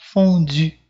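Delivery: "Fondue" ending in a pouted French u; "Fondue" with an I said through rounded lips. Shown as a demonstration. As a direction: pout the last vowel of "Fondue". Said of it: "fazer um pouquinho mais do biquinho".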